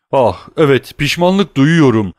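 loud, high, slow anguished cry; loud slow anguished outcry. A narrator's reading. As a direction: cry out your repentance. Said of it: "diye haykırdı Danglars".

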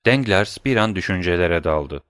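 ordinary delivery

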